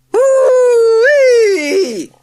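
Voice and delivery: very high, very loud whoop; joyful whoop. A narrator's reading. Charlie whooping as the bus flies, joyful. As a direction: whoop with pure joy. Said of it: "cried Charlie joyfully".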